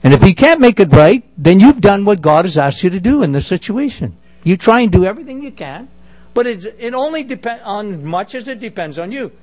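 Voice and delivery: ordinary talk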